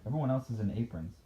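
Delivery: plain reading voice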